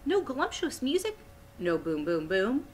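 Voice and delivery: questioning, jumps high then drops and climbs; lightly acted, incredulous questions; pleading giant. A narrator's reading. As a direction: voice a giant asking hopefully for music. BFG pleads for music.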